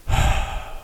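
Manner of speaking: imitated heavy exhale; pissed-off sigh. Boss hearing of the firing, imitated exasperated sigh. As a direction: let out an exasperated breath, no voice. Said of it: "fucking pissed".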